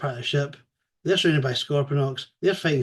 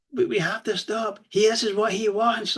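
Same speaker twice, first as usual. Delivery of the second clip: higher frantic pleading voice; frantic Starscream; 7 semitones higher than usual. Retold or imitated. Starscream warning Megatron in a chokehold, frantic. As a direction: plead urgently, pitched up.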